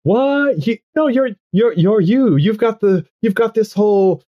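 high-pitched, stumbling astonishment; flustered disbelief. Acted. Goth girl stunned that Earl would ask her, flustered. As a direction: burst out high, restarting each phrase. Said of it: "she was so nervous".

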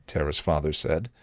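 ordinary delivery, nothing marked

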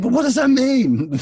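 very high, loud comic yelp; hammed-up baffled squeal; exasperated wail. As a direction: cry it out in mock desperation. Baffled retold plea to crew.

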